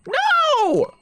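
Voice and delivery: very high, squealed protest; hammed-up high yell of refusal; mock outraged cry. Streamer answering people calling it a slur, mock shriek. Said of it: "And I'm like".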